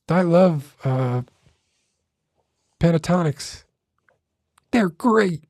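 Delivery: squeaky high parody ASMR host; high mock ASMR voice. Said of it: "what sounds like a smoker's voice".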